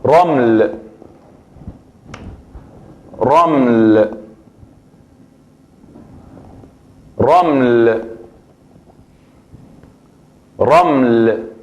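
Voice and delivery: slow teacher demo of raml with trilled r; Arabic word raml. Shown as a demonstration. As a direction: say raml slowly, r rolled. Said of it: "que es". (pronunciación árabe de raml, lenta)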